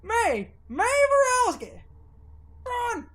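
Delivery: high, loud yell across the dark; shouted warning. Acted. Warning May Borowski to run, shouting loudly. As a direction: call out to warn her.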